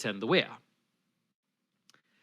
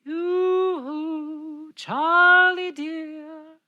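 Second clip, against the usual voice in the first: much higher, slow sing-song call; tinkly mermaid voice; 13 semitones higher, 4 dB louder, much slower than usual. A narrator's reading. Mermaid calling to Charlie, high and tinkly. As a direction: coo his name like a sweet little mermaid. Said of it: "sort of tinkly and clear".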